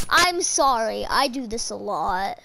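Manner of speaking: ordinary talk, nothing marked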